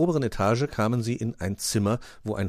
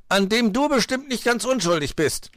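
high, loud accusation; accusing; 6 dB louder than usual. A narrator's reading. Fox blames Pechmarie for the nap, accusing. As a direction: throw the blame at her sharply.

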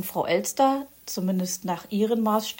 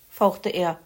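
plain reading voice

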